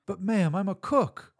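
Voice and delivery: high desperate protest; pleading. Acted. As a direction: plead helplessly. Cook protesting in panic.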